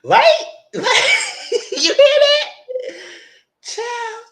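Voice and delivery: high, loud cackling through the words; loud, squealing laughter in the voice; laughing. Real, not acted. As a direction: crack up while talking. Cracks up at own recap.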